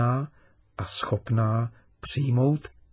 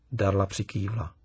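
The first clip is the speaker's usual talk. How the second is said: plain reading voice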